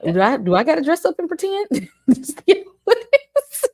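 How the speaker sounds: high, laughing as he asks; laughing musing. Retold or imitated. Joking to co-hosts, high laughing musing. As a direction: giggle through the thought. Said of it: "Almost made me think".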